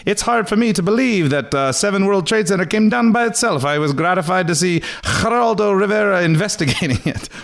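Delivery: hammed-up high impression of a TV judge; Napolitano voice. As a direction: squeak out the quote like a pompous judge. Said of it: "Napolitano stated".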